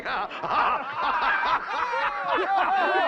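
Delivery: loud derisive laugh; mocking laugh. Auctioneer laughing at the boy before the crowd, loud.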